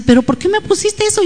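high, hurt, questioning whine; upset grown child. Retold or imitated. Her child asks why she sent that, high and offended.

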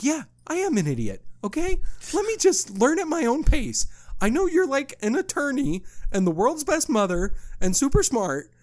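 exasperated comeback. Retold or imitated. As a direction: snap back at her, mock defensive. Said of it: "I'll just be like".

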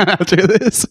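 high-pitched, giggly delivery; real laughter. Real, not acted. Comic joking to his friend about blocking him, laughing. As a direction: let laughter break into the words. Cracking up at teasing the guy.

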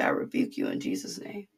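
low, hushed rebuke; under the breath. Shown as a demonstration. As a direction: murmur it privately under your breath. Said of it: "Whisper it on your breath".

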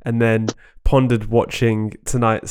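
ordinary delivery

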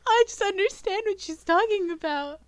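high, loud tearful imitation; hammed-up weepy voice, high-pitched; mock crying. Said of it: "Jackson is crying 'cause she's like".

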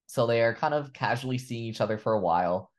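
normal talking voice

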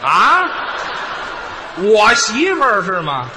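mock indignation. Straight man hears his wife is the fortune-teller, mock outrage. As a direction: burst out loud, then drag the question.

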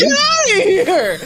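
high, loud, slow laughing dismissal. Real, not acted. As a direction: wave it off, cracking up.

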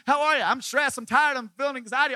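high, loud whiny moaning; mock the stressed-out churchgoer, loud and high; mocking stressed Christians. Pastor mocking stressed believers, high loud whine. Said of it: "they say all the time".

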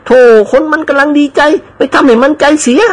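put-on high, chiding old lady voice; old lady scolding. Aunt chides nephew for upsetting servant, high chiding voice. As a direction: chide the nephew for spoiling joy.

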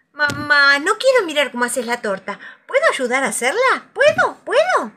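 voz infantil aguda que suplica; niño entusiasmado. A narrator's reading. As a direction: ruega con ilusión, cada vez más agudo. Niño ruega ayudar a mamá. (súplica impaciente de niño, fuerte)

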